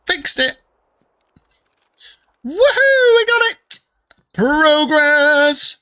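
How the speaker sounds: high, loud triumphant whoop; excited cheer. Real, not acted. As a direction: cheer it like you just won. Celebrating fixing it in game.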